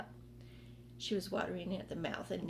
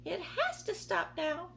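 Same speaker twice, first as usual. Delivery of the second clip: yelling aunt; 9 semitones higher than usual. Acted. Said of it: "Aunt Spiker yelled".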